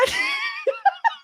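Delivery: high-pitched squealing laugh. Real, not acted. Laughing at own accent being questioned.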